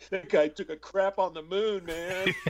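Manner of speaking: high-pitched, comic aside to a buddy; lightly hammed-up high voice; Bill Paxton. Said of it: "And he goes".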